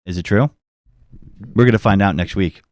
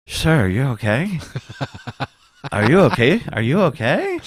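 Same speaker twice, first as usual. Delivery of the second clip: Mike with Invisaligns; 5 semitones higher than usual. Retold or imitated. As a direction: mimic a friend talking through braces. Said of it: "kinda like this".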